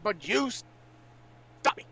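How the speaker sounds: ordinary talk, nothing marked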